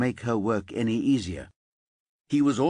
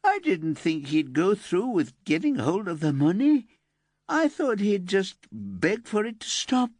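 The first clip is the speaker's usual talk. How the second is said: higher, tearful-edged pleading; pleading teenage girl; 11 semitones higher than usual. Acted. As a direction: beg them to understand. Elsa pleads.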